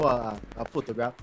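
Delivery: ordinary delivery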